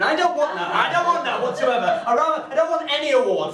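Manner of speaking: mock protest. Ironic denial of wanting award.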